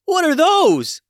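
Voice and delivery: high, loud startled shriek; yelling. A narrator's reading. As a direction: shriek at her own toes. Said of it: "yelled Gwen".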